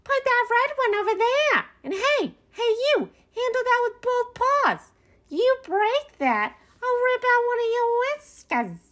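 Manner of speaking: very high-pitched bossy raccoon voice; yelling orders. A narrator's reading. Beasley orders raccoons unpacking bird feeders, yelling. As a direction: order the workers about in a high character yell. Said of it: "stand to the side and yell orders".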